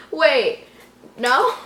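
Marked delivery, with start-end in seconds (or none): none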